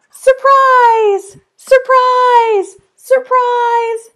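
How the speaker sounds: high, loud gasping "Surprise!"; big storybook reveal; excited surprise. Acted. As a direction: burst out with delight for the kids. Big reveal in picture book.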